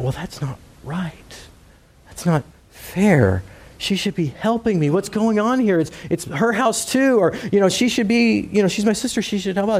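high, whiny complaining sister voice; Martha complaining. Acted. Martha fussing to herself about her sister, high and whiny.

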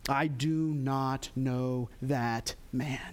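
slow, low, measured denial; solemn oath. Acted. Peter denies Jesus a second time, solemn oath. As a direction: space out every word like a sworn statement. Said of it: "with an oath".

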